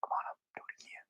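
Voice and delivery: fast whispered pastor cue; pastor's whisper. Retold or imitated. Retelling the pastor's quick whispered cue to the band. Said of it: "the pastor comes up".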